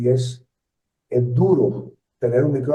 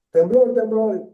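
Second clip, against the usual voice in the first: higher-pitched mock cry of alarm; imitating shouted alarm; 9 semitones higher than usual. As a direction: call it out like a startled crowd, only half shouted. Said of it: "está la gente gritando". (grito de alarma imitado, a media voz alta)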